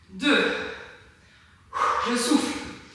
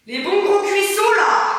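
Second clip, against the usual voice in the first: hyped up; 8 semitones higher, 4 dB louder than usual. Real, not acted. Hyping burning thighs.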